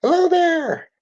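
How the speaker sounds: very high put-on old voice; grandpa greeting. A narrator's reading. Grandpa greeting sick Ralphie in a high voice. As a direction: squeak out a friendly hello.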